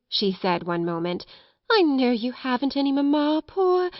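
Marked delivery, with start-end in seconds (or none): 1.7-3.9 higher, coaxing coo; soothing pity. A narrator's reading. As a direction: humour a bereft child tenderly. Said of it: "first one method and then another".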